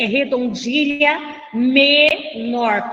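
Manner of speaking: MENOR drawn out slowly, split as me... nor; slow teacher's correction, pausing inside "menor"; emphatic correction. Shown as a demonstration. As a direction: stretch "menor" into two separate beats. Teacher correcting term, stretched out.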